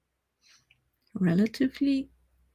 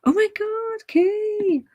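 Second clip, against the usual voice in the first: high, delighted squeal of surprise; excited; slower than usual. Real, not acted. Streamer reacting to a viewer's chat message, excited high squeal. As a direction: gush with real delight at a viewer.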